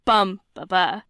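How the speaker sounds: "bum, ba, ba" with BUM stressed; strong "bum", then two light "ba"s; strong, weak, weak on "bum, ba, ba"; stress on first beat. Shown as a demonstration. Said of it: "a stressed followed by two unstressed".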